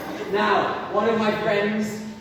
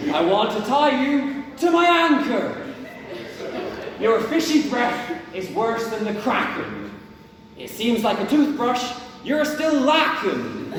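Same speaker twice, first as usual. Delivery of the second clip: a pirate captain's mocking insult poem in a pirate accent; 2 semitones higher than usual.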